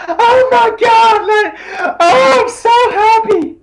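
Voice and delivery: high-pitched, drawn-out mock ecstasy; big stoked. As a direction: act out being over the moon, hammed up. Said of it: "we're talking big stoked, like".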